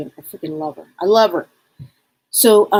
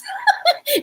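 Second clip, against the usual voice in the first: high laugh. Real, not acted. Laugh mid-song.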